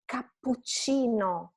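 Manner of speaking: Cappuccino slowly, holding PP and CC; double consonants. Shown as a demonstration. Teacher shows students Cappuccino with both doubles held. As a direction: break it as Cap-puc-ci-no with firm doubles. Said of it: "two double consonants".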